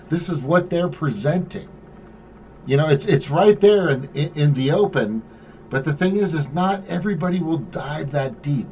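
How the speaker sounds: ordinary talk, nothing marked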